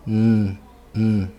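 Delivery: N as an isolated voiced hum; N vozeado. Shown as a demonstration. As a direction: hum the N so the throat vibrates. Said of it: "Bota a mão no pescoço". (N sustentado, vibrando na garganta)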